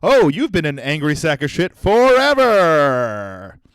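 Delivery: loud, slow, put-on taunt; hammed-up taunting voice; mocking joke voice. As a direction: drag it out like a punchline. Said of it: "that joke where it's like".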